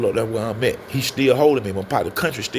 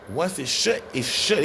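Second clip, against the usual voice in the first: ordinary talk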